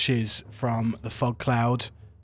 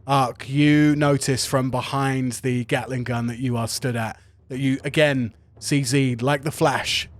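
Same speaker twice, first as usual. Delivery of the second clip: usual speaking voice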